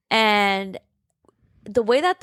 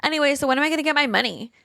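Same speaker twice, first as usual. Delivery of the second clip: quick, higher-pitched mock indifference; apathetic; 6 semitones higher, much faster than usual. Wife asking the lawyer for her money, apathetic. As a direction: brush past it, acting unconcerned. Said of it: "Wendy is super, like, apathetic".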